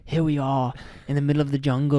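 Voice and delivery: put-on loud jokey British accent, close to Australian. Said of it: "We can even talk in a British accent".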